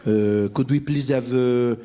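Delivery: put-on low, halting French-accented English. French diner ordering South African food, low French accent. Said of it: "no French people sitting in our restaurants in South Africa".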